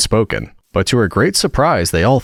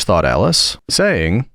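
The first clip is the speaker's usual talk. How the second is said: ordinary delivery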